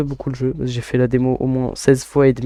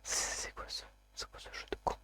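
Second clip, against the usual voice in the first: whisper; 21 dB quieter than usual. Real, not acted. Frustrated muttering at the game.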